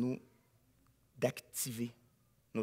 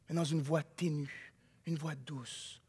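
much softer, lower, gentle murmur; 5 semitones lower, 10 dB quieter, slower than usual. Acted. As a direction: sink to a gentle hush. Describes God's gentle voice. (voix douce et ténue)

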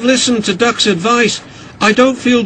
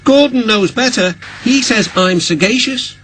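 high, self-important retort; huffy; 9 semitones higher than usual. A narrator's reading. Oliver boasting of Gordon's praise, huffy and high. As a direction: boast back in an offended high voice. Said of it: "he said huffily".

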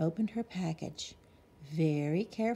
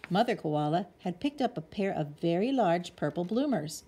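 plain reading voice

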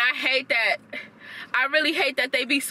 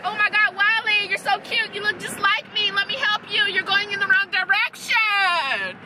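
mocking Iris cooing; 7 semitones higher than usual. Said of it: "It was just like".

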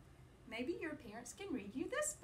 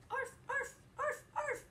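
high, loud little arf; puppy yip; 5 dB louder, slower than usual. Acted. Teacher voicing the little pup for children, loud squeaky yip.

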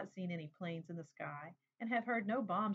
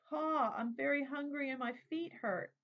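put-on child calling out; 6 semitones higher than usual. A narrator's reading. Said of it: "I yell to him".

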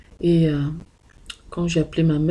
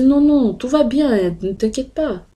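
high, loud "non, non" reassurance; eager reassurance; 7 semitones higher, 4 dB louder, slower than usual. Retold or imitated. Daughter reassuring her worried mother, pitched high and loud. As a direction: rush to calm her mother, much higher and louder.

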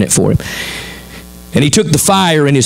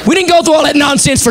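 indignant mama; 13 semitones higher than usual. Acted. Sarah objecting, Mama outraged.

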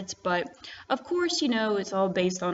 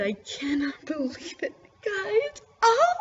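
high teary voice breaking into a squeal; overwhelmed happy crying; tearful joy; 11 semitones higher than usual. Real, not acted. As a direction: choke up then squeal. Overcome after rare drop.

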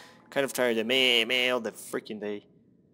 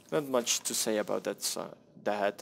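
ordinary delivery

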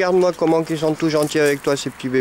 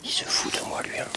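soft unvoiced whisper to the camera; whispered aside; 10 dB quieter than usual. Real, not acted. Owner whispering that the dog mocks her. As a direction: breathe it out as a secret.

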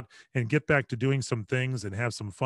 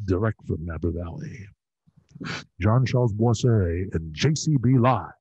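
announcer voice; 2 semitones lower, 4 dB louder than usual. Shown as a demonstration. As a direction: present it like a grand live-event opener. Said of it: "Like something like that".

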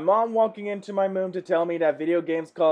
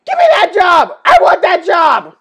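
loud, high-pitched demand; excited yell; 10 semitones higher, 10 dB louder than usual. Real, not acted. Viewer yelling at a meme about a paid job, excited yell. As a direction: yell it out in comic envy.

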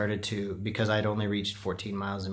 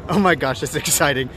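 breathless, loud excitement; excited; 5 semitones higher, 8 dB louder than usual. Real, not acted. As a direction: gush with real excitement. Excited arriving at marathon start.

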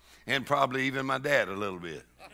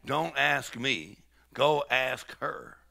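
slow drawn-out reply; much slower than usual. Retold or imitated. Dad's retold reply.

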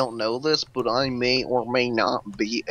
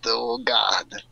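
normal talking voice

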